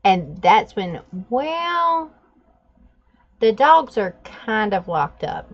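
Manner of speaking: ordinary talk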